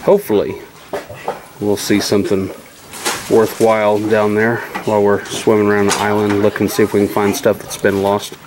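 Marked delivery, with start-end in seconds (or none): none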